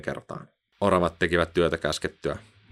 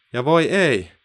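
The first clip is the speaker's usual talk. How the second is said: higher, louder alarm; dismayed oh no; 8 semitones higher, 5 dB louder, slower than usual. A narrator's reading. Narrator exclaims as the net slips away. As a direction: sound the sudden alarm.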